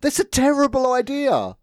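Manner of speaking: high-pitched exasperated verdict; incredulous rant. Real, not acted. Podcaster condemns blowing up a petrol station, incredulous. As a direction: land the protest in comic outrage.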